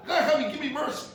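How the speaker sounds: high, loud plea building to a cry for help; acted tearful prayer. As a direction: beg God desperately, as in prayer. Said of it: "You have to daven with tears to your eyes".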